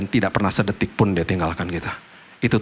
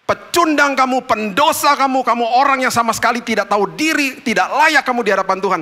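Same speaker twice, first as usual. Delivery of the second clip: high, harsh accusing voice, louder; 14 semitones higher, 4 dB louder than usual. Retold or imitated. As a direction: sneer the accusations at the sinner. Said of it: "dia akan katakan begini".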